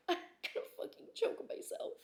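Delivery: very soft voiceless mock threat; whispered aside. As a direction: whisper it under your breath. Acting out her exasperated reaction.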